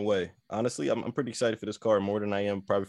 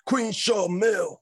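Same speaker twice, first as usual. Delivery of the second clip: higher, slow royal announcement; slow drawn-out announcer call; 9 semitones higher, much slower than usual. Retold or imitated. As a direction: draw out Queen Sharmell like a herald. Imitating Booker T's call.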